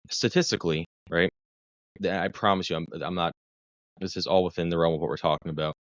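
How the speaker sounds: normal talking voice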